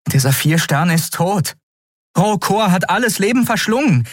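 high, urgent argument; agitated insistence. A narrator's reading. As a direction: press the point, exasperated.

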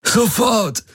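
high, strained urgent cry; urgent, pained command. A narrator's reading. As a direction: demand it desperately through the pain. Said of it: "brachte Garlin hervor". (gequälter, dringender Befehl)

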